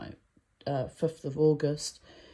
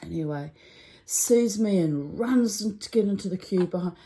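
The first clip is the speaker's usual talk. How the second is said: normal talking voice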